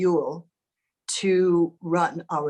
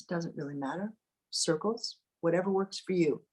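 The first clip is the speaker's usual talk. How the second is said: guided meditation; 5 dB quieter than usual. Acted. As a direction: ease them into calm, close and gentle. Leads a meditation.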